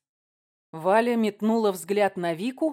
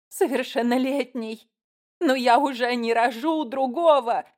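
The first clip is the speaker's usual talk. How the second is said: high, raised anguished retort; mild mock wail; 5 semitones higher, 5 dB louder than usual. A narrator's reading. Mother wailing over her only son, acted without sobs. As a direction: throw the word back in lightly acted despair. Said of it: "зарыдала женщина в голос".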